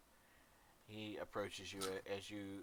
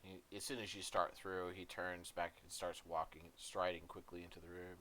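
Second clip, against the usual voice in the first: ordinary delivery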